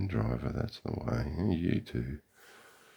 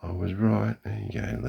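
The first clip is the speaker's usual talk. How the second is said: triumphant; 5 semitones higher, 6 dB louder than usual. Real, not acted. His prediction about golfers comes true.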